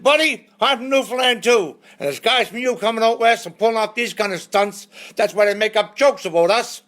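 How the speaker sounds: high, loud scolding tirade; mock angry rant. Fellow Newfoundlander scolding the brother from the roadside, angry rant. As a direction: tear into him like a fed-up countryman.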